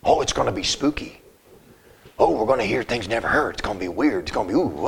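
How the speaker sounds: low, hushed mock-spooky thrill; spooky wow voice. Preacher mocking people who want Revelation to be spooky, hushed. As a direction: tease their taste for spooky stuff. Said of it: "a wow factor".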